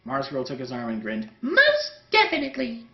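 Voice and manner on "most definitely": put-on much higher, bright girl's reply. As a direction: answer like an eager young woman. Mars Girl answers Linkara.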